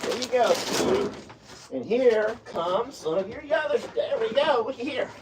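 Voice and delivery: hushed doting words; soft coaxing pet talk. Real, not acted. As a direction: gently coax the cats to the food. Softly coaxing the cats.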